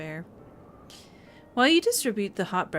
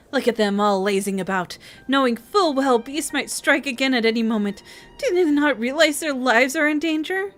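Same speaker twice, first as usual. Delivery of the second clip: put-on louder, rising, nervous young villager; anxious villager; 5 semitones higher, 6 dB louder than usual. Scared villager complaining to the player, lightly voiced anxious rant. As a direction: fret about the lazy others.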